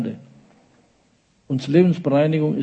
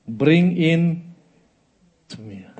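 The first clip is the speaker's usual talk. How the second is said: resigned command; 3 semitones higher, slower than usual. Acted. First take of Jesus' command.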